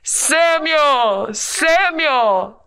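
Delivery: lightly voiced God, drawn out; God calling. Storyteller voices God's third call, mild. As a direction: call his name slowly like God. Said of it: "God called out to Samuel".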